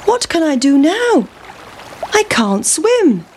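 high, worried pancake voice; helpless pancake. Acted. Pancake to pig at the river, helpless. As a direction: wail it high, slowing at the end.

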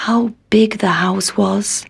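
awed hush; whispering. A narrator's reading. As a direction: breathe it in wonder. Awed narration.

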